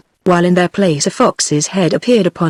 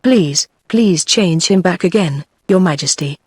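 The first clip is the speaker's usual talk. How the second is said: ordinary delivery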